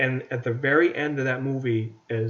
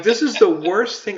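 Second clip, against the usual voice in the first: higher and louder, exasperated; outraged disbelief; 7 semitones higher, 6 dB louder than usual. Retold or imitated. Fan fuming at the movie's ending, outraged cry. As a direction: blurt it out in disbelief. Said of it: "I'm like".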